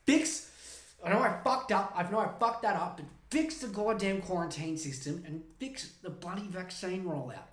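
normal talking voice

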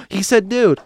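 high shocked gasp at dude; lightly voiced shocked non-American; gasping foreigner. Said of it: "my non-Americans go".

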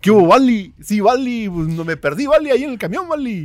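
put-on loud, high sing-out in Guanajuato accent. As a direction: exaggerate the Guanajuato accent, calling to a buddy. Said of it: "tenemos un acento muy reconocible". (acento guanajuatense exagerado, agudo y fuerte)

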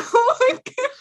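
squealing laugh, high and loud; high burst of laughter. Real, not acted. Host laughing at her own joke, high squeal. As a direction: break into a high giggle.

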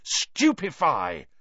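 slow, drawn-out spell cry; shouted spell. Acted. Harry casting at the Scroot, slow forceful cry. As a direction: fling the curse out hard. Spell cast in maze fight.

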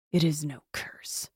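hushed, unvoiced ghost whisper; a cold whisper from the dead; ghostly whisper. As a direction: breathe it out like a malicious shade. Ghost answers with malice.

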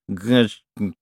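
"G-, g-" as a garbled stutter; tongue-tied. A narrator's reading. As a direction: stammer "G-, g-" without reaching a word. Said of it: "human tongue couldn't keep up".

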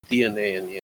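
ordinary talk, nothing marked

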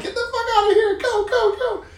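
very high, loud mock evacuation order; frantic, hammed-up high holler; urgent acted command. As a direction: yell it like an emergency retreat. Acting out aborting the restaurant mission.

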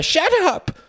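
very high, loud shriek; cried out. A narrator's reading. Wife cuts off husband's insult, crying out. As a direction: cut him off with a scream. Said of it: "she cried".

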